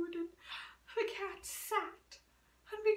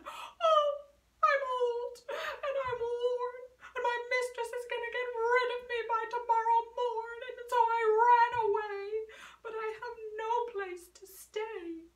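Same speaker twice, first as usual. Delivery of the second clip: higher, tearful cat; sad old cat; 5 semitones higher, 5 dB louder than usual. Acted. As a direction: plead as the worn-out cat. Cat answers dog.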